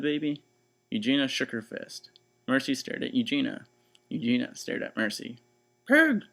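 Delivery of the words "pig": high, loud angry yell; furious shout. Acted. Eugenia faces the pig at the window: furious loud shout. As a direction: spit out the word in fury. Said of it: "shouted Eugenia".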